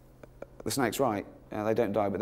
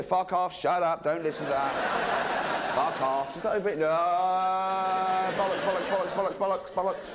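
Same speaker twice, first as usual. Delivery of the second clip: high, insistent heckling; mock interrupting God; 6 semitones higher than usual. God shouts down the snake talking to Eve, mock interruption. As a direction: drown out the talker with repeated comic protests.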